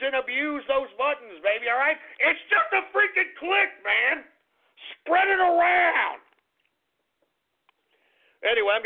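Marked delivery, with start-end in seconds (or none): 2.2-4.3 high, insistent plea; exasperated urging. Real, not acted. Host urging listeners to click share buttons, exasperated. 5.0-6.2 high, drawn-out rallying call; emphatic urging. Real, not acted. Host urging listeners to share, drawn-out call.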